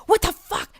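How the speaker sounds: hammed-up angry yell; mock angry cashier. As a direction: play the outraged cashier. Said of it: "they're pissed off at you".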